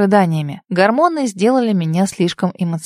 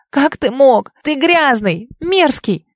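high, hurt, accusing; tearful angry outburst; 6 semitones higher, slower than usual. A narrator's reading. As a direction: lash out through tears. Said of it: "всё вокруг расплылось от слёз".

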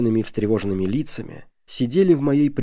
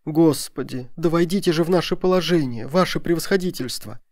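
higher-pitched entreaty; pleading exclamation; 6 semitones higher than usual. A narrator's reading. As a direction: beg to be understood. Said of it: "воскликнули они".

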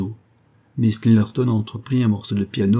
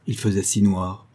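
usual speaking voice

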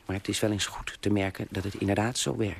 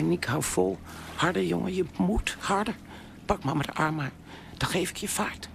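high, strained pleading to keep swimming; urgent calling; 10 semitones higher than usual. Retold or imitated. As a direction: push him on, voice tight with fear. Mother urges son past ship.

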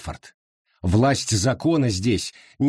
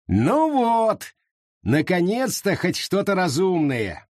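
high, smug approval; 7 semitones higher, slower than usual. A narrator's reading. Wallis to the reverend, high and smug. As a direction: gloat as if you've won.

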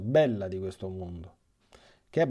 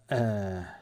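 ordinary delivery

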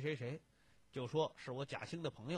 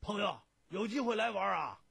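loud, high hearty send-off; emphatic friend's farewell; 8 semitones higher, 9 dB louder than usual. A narrator's reading. Friend ending a phone call with an invitation, emphatic. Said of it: "总要强调一句".

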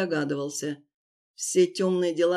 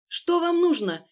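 frightened; 10 semitones higher, 4 dB louder than usual. Acted. Frightened victim digging.

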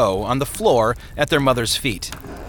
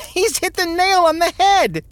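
put-on very high, loud boy laughing through the words; uproarious laughter; 5 dB louder than usual. A narrator's reading. Boy laughs at his brother's impression of father, uproariously. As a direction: burst out laughing as you say it. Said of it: "laughed uproariously".

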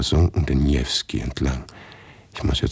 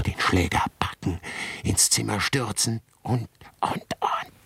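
whispered thought; 4 semitones higher, 4 dB quieter than usual. A narrator's reading. Narrator whispers what horror must still be done.

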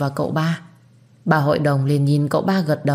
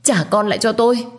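higher, faster frantic pleading; panicked plea; 6 semitones higher, faster than usual. A narrator's reading. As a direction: beg frantically for her baby. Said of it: "hoảng loạn và gào thét, khóc thảm thiết". (van xin hoảng loạn)

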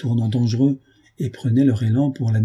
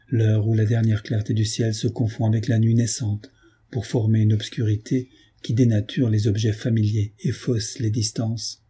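ordinary delivery, nothing marked

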